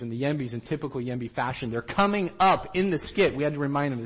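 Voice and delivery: normal talking voice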